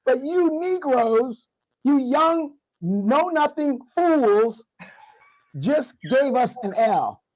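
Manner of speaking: theatrical slow rant; mock outraged scolding. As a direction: scold the young fools with mock fury. Mock scolding of young men.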